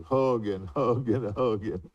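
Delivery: ordinary delivery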